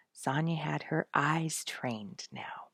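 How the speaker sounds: plain reading voice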